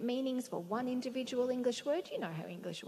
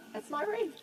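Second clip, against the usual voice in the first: pleased realisation; 13 semitones higher, 3 dB louder than usual. Acted.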